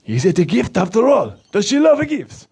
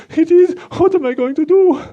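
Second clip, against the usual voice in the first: panicky; 10 semitones higher, 3 dB louder than usual. Acted. Puppet panicking about no gift.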